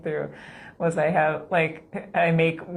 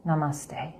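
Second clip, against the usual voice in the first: usual speaking voice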